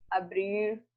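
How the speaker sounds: abrir with a clear strong R at the very end; strong final R. Shown as a demonstration. Teacher demonstrating final R in abrir to pupils. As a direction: stretch the closing R of abrir. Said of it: "O som do R é forte no final".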